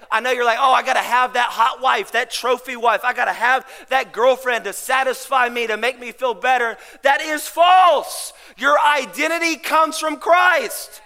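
loud, emphatic pulpit delivery; full-force preaching. Real, not acted. Pastor urging the men to find identity in Christ, preaching hard.

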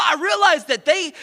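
excited outburst. Acted. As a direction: keep riding the outrage. Excited rant about the company.

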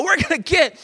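hammed-up fury at the company; mock outrage. Dad ranting about the faulty pieces, hammed-up outrage. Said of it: "I'm like".

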